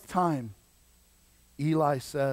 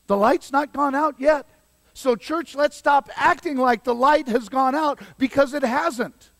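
high, loud preaching exhortation; 9 semitones higher, 6 dB louder than usual. Real, not acted. Preacher urging the church to stop acting defeated, emphatic preaching. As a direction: urge the congregation with raised voice.

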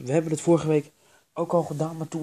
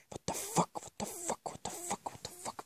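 high, squeaky near-whisper; soft, very high repeated exclamation; hushed panic; 11 dB quieter than usual. Acted. Alarmed while hiding.

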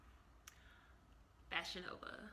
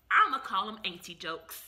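ordinary talk

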